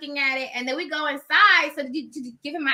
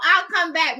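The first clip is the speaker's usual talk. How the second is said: ordinary talk, nothing marked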